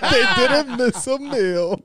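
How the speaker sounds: high, slow laughing repeat. Real, not acted. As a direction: say it while cracking up. Mocking echo through laughter.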